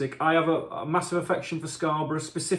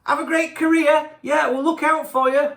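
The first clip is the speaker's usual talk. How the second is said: high, louder mock well-wishing; cheery send-off; 11 semitones higher, 7 dB louder than usual. Medical staff wheeling the singer out after surgery, cheery send-off. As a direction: call out an overexcited goodbye.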